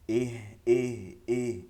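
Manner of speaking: "ইহ" slow and falling, with no stray puff of air; no escaping air. Shown as a demonstration. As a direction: say "ইহ" slowly without letting breath hang. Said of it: "কোনো বাতাস বসবে না". ("ইহ" বাতাস না বসিয়ে)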